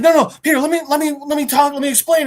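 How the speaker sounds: high, hurried begging; mock pleading. Yurik pleads to explain himself.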